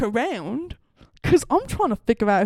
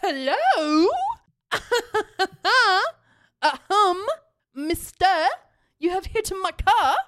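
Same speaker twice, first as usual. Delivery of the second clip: higher, drawn-out calling-out voice; pointed mock politeness; 8 semitones higher, slower than usual. Driver calls to the man who hit her car, pointedly polite. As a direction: get a stranger's attention with exaggerated courtesy. Acted confrontation through car window.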